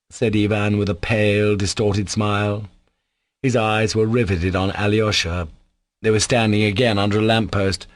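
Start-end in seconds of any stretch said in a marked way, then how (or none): none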